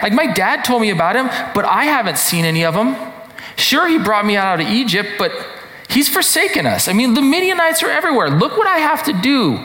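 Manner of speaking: high, exasperated venting; ticked off. Shown as a demonstration. Gideon complaining that God has forsaken his people, ticked off. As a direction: argue back in frustrated disbelief. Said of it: "That's how I read this".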